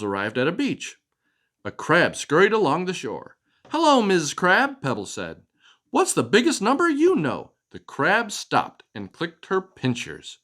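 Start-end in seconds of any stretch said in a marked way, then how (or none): 3.7-4.8 high, eager little butterfly; bright butterfly voice. Acted. Butterfly greets crab. 5.9-7.5 high, eager little butterfly; bright butterfly voice. Acted. Butterfly asks.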